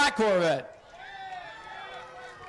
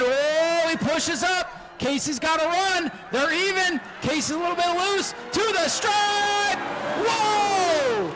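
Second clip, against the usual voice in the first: higher, shouted race call building to the finish; excited play-by-play; 6 semitones higher, slower than usual. Acted. Announcer calling a photo finish for the crowd, excited shouting.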